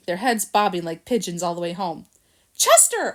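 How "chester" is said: a high, loud call of the name; cried out name. A narrator's reading. Harold spotting his friend Chester in the bungalow, glad call. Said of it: "I cried".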